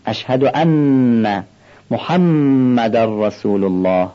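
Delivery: slow, with the doubled n of ان and m of محمد held and nasalised; nasal ghunna. Shown as a demonstration. Teacher showing students ghunna, slow. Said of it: "بهش میگن غنه".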